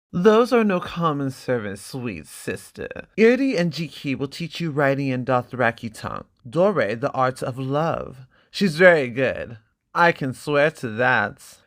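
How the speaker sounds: lightly voiced cruel brother, lingering on the insults; sneering brother. Brother taunting his sister at the feast, smug and mocking.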